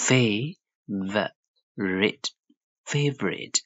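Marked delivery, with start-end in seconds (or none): 0.1-2.3 very slow "fa - vo - rite"; syllable by syllable. Shown as a demonstration. Teacher breaks the word down, favorite in slow syllables. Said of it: "Rate လို့ထွက်ပါတယ်".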